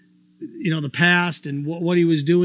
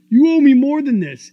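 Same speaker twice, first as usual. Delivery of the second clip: hurt demand; 7 semitones higher, 7 dB louder than usual. Acted. Acted breakup quarrel.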